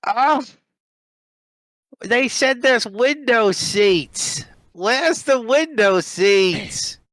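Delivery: put-on high, slow, drawn-out whining old woman; fussy old lady. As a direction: squeal it out like a fussy little old lady asking everyone. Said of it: "And she's like".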